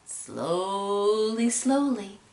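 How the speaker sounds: long, stretched "slowly, slowly"; drawn-out. A narrator's reading. Narrator shows peddler plodding back to town, drawn-out. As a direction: stretch the vowels to act out slowness.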